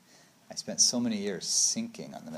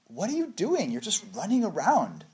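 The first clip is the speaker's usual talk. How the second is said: high-pitched, sing-out mock disbelief; mock exasperation; 9 semitones higher than usual. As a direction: exclaim it high, as if baffled by all the running. Scolding the running, retold.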